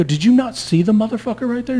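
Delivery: high-pitched retold disbelief; incredulous challenge. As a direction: act out exasperation, voice pushed way up. Retelling exasperated question to police.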